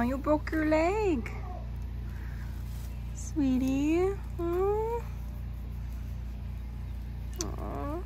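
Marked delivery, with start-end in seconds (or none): none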